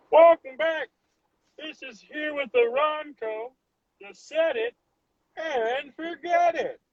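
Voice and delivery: high, slow announcer voice selling the Ronco; hammed-up TV pitchman, drawn out; infomercial host. To a friend, imitating the Ronco infomercial host, high and slow. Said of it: "Remember that infomercial?".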